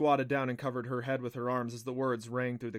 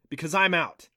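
loud, raised admission of defeat; frustrated; 5 semitones higher, 9 dB louder than usual. A narrator's reading. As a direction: fling it out, fed up. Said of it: "he demanded in frustration".

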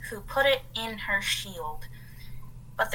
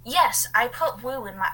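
usual speaking voice

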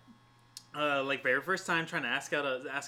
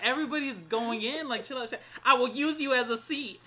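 a hint of a pushy stranger, high and loud; lightly voiced bully in a crowd; half-laughing imitation of a rude threat; mock rude stranger; 10 semitones higher, 6 dB louder than usual.

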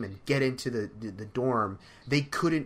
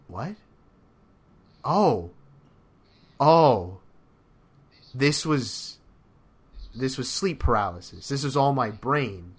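loud surprised oh; retold sudden realization; startled realization; 5 dB louder than usual. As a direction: realize it was all a dream. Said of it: "and I'm like".

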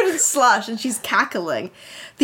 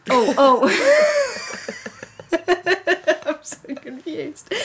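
real laughing fit; 7 semitones higher, slower than usual. Real, not acted. Reader losing her place in the story, laughing helplessly. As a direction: squeeze it out while helplessly laughing.

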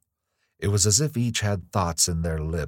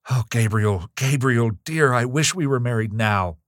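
high, louder anguished plea; distressed cry; 5 semitones higher, 5 dB louder than usual. A narrator's reading. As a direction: cry out to a beloved in sudden distress. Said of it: "Evelyn cried in sudden distress".

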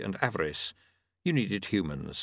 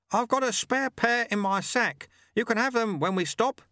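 high-pitched, carrying character voice; sweeper calling over wind; 12 semitones higher than usual. Acted. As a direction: call it out high to a fellow rider.

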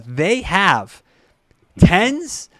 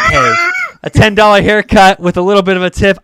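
loud, higher, upbeat exclamation; excited reaction; 4 semitones higher, 10 dB louder than usual. Retold or imitated. Broke young man welcoming a cheap haircut, excited. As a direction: jump on the bargain loudly.

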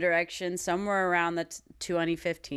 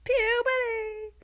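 high drawn-out call; 13 semitones higher, much slower than usual. Retold or imitated. Repeats slowly, high.

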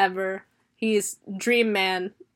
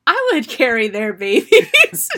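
slow, louder swooning declaration; 2 semitones higher, 6 dB louder than usual. Pretend. Her estrogen voiced as a slow swooning gush. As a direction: gush it out slowly. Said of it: "And my estrogen goes".

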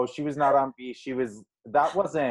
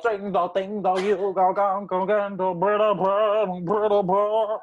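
higher mock singer voice; 6 semitones higher, 3 dB louder than usual. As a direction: parody the singer's vocal tics. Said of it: "Like, it's just terrible".

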